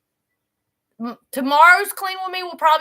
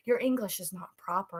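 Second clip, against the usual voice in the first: school critic; 6 semitones lower, 9 dB quieter than usual. Retold or imitated. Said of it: "they said".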